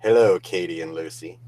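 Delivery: half-hearted low villain greeting; low, soft, barely voiced villain impression; James Bond villain attempt. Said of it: "Try doing a James Bond villain thing".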